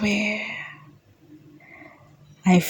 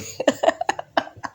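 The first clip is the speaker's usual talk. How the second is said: breathy happy giggle; light whispered giggle; soft giggle; 4 dB quieter than usual. Retold or imitated. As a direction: giggle like a giddy lover. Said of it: "I start giggling".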